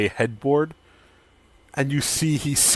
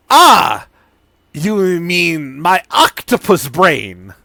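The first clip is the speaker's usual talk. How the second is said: much higher and louder eager pitch; mad scientist Sidon; 4 semitones higher, 9 dB louder than usual. Acted. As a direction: sell the potion like a gleeful mad scientist.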